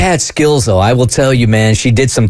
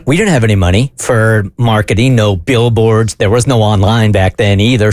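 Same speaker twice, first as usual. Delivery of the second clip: ordinary talk, nothing marked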